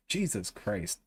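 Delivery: ordinary talk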